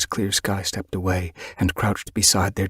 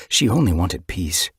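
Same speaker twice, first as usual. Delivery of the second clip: plain reading voice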